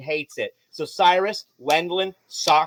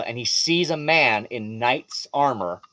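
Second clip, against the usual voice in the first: ordinary talk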